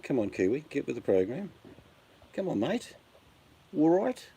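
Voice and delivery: high, teasing egging-on; playful high-pitched come on mate; cheeky urging. Acted. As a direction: call out to the Kiwi, voice jumping way up. Urging the absent Kiwi on.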